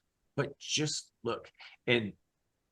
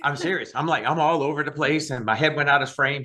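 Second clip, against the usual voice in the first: loud, excited and higher; fired up; 4 semitones higher, 9 dB louder than usual. Real, not acted. Guest fired up about faith, talking loud and excited to the interviewer. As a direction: let the enthusiasm spill over. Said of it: "I want you to get fired up".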